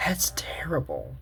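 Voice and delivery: hushed real whisper; a soft unvoiced sigh of sympathy. Real, not acted. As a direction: breathe it out in dismay. Reaction to the sad backstory.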